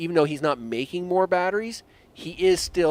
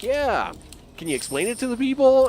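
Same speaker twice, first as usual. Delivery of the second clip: higher, quicker mock-earnest voice; talking to his brain; 9 semitones higher, 4 dB louder than usual. Gamer voicing himself pleading with his brain, higher and quick. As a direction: pitch it up and hurry, playfully nudging. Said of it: "And I'm like".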